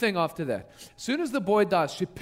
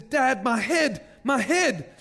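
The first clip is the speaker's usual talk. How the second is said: higher, drawn-out cry to a parent; pained boy; 5 semitones higher, much slower than usual. Retold or imitated. Boy calls to his father in the field, pained. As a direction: act out a child's hurting cry, mildly.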